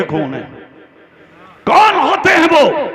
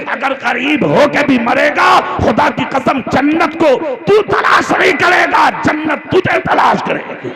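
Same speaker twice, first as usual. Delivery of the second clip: higher, louder impassioned sermon, building to shouts; preaching at full force; 6 semitones higher, 3 dB louder than usual. Acted. Preacher rousing the Talakpur crowd, impassioned full-force sermon. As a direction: preach it like a fiery mosque orator, rising to a roar.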